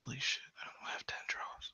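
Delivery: unvoiced, hushed whisper; barely audible private whisper; whispered aside. Real, not acted. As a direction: whisper it under your breath. Whispered aside about lacking draws.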